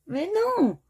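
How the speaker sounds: sharp high outcry of disbelief; startled high protest; surprised. Real, not acted. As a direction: jump up in pitch, crying out no. Sudden disappointment in the game.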